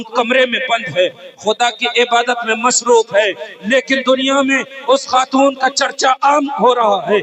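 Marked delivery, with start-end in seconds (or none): none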